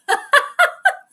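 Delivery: loud high cackle of laughter; helpless high laughing; laughing fit. Real, not acted. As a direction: crack up loudly, high-pitched. Cracks up at the interception.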